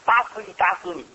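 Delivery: mock threat of a mother hitting her child; angry mother. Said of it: "有些妈妈怎么一打他孩子". (模仿妈妈打孩子时的气话)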